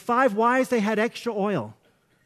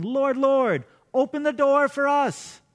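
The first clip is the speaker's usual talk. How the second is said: raised, higher acted call; 6 semitones higher, 3 dB louder, slower than usual. Bridesmaids shut out of the feast, calling to the Lord. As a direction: call out as if locked outside. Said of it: "they stood outside calling".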